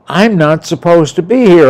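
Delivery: loud, high insistence; emphatic retold plea; retold scared protest. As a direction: protest it forcefully, as if to talk him out of it. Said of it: "I was scared to death".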